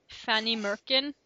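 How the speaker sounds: normal talking voice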